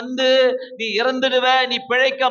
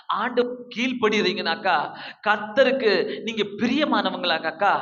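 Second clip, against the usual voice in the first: normal talking voice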